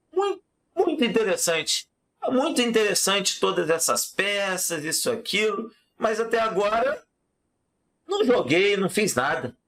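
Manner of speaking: a lightly voiced king; king's voice. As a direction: play the king, mildly puzzled. King voiced in told story.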